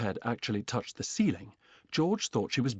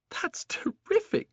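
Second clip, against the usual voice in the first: put-on excited boy; 3 dB louder, slower than usual. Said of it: "George cried".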